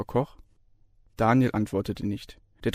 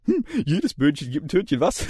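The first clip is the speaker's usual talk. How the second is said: higher, playful joking voice; teasing lecturer; 5 semitones higher than usual. A narrator's reading. Lecturer teases the student, playfully higher. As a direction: tease it out lightly. Ossip jokes after fart.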